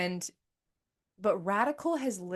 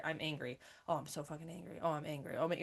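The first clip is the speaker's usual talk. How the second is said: low, soft, held-down grumble; understated irritation, quiet and contained; suppressed anger; 4 semitones lower, 6 dB quieter, faster than usual. Retold or imitated. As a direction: imitate anger kept at a low level. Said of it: "just, like, leave at this level".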